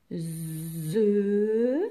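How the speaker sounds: ze with a long buzzing z and held vowel; drawn-out syllable. Shown as a demonstration. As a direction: stretch ze slowly. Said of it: "donc il fait Z".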